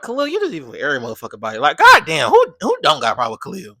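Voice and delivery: loud, fast, disbelieving rant; exasperated rant. Retold or imitated. Ranting about everyone beefing with Khalil, loud and fast. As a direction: fire off the questions quickly. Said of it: "And I was like".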